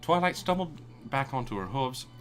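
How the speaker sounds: ordinary talk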